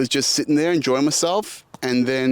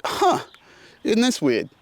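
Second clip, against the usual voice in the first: a high, puzzled "Huh", then a raised question; mock surprise; 8 semitones higher than usual. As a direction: play it as a bemused double take. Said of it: "but I was just like".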